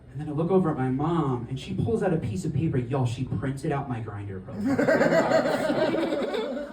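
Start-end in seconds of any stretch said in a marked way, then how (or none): 2.8-6.7 high, slow, laughing through it; laughing disbelief. Real, not acted. Comic telling the crowd, laughing in disbelief. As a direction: drag the reveal out in disbelief.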